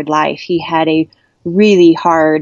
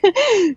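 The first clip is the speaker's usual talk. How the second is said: breathless laugh. Real, not acted. Laughing mid-scream.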